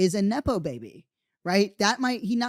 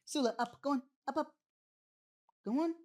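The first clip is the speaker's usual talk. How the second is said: high, soft coaxing to a dog; 9 semitones higher, 8 dB quieter, faster than usual. Real, not acted. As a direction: sweet-talk the dog up onto the bed. Coaxing the dog onto bed.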